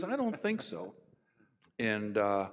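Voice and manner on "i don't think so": much higher, innocent and unbothered; pitched-up friend voice, matter-of-fact; Charlie's innocent reply. Retold or imitated. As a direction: answer sweetly, like it's obvious.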